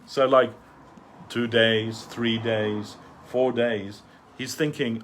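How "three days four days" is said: ordinary talk, nothing marked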